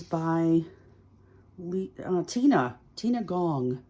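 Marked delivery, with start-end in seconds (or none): none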